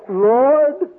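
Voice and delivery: put-on high, slow southern child's prayer; little southern boy praying. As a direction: imitate a southern boy pleading with the Lord. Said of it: "He's a little southern boy".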